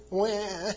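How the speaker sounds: high-pitched imitated "wah"; mock whining wail. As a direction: wail like a whiner, hammed-up. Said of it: "kind of a whining".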